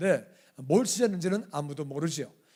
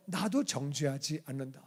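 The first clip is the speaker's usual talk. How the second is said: gentle forgiveness; 3 semitones lower, 7 dB quieter than usual. Retold or imitated. Jesus forgiving the woman.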